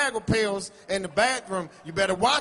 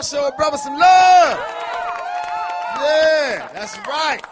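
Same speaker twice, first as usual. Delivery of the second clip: hype shout; 3 semitones higher, 9 dB louder, slower than usual. Acted. Stand-up comic shouting to the Baltimore crowd, loud hype. Comedian hyping the club crowd.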